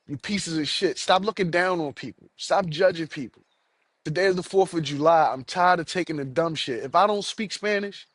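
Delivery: ordinary talk, nothing marked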